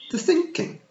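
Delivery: a tiny Scots fairy queen, pitched high; Kelda voice. Acted. Kelda answers Tiffany.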